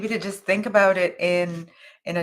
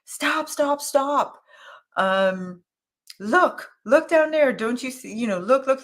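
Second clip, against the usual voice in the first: higher, eager calls to stop and look; urgent excited calls; 6 semitones higher than usual. Retold or imitated. Old man on the bridge urging me to look, excited higher calls. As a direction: flag someone down, eager and insistent. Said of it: "and he said to me".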